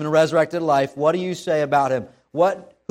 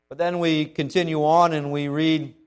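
normal talking voice

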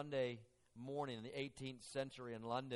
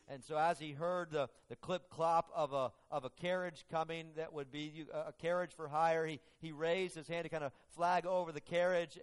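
louder, higher storytelling surge; building sermon story; 4 semitones higher, 5 dB louder than usual. Acted. Preacher driving the carriage story louder.

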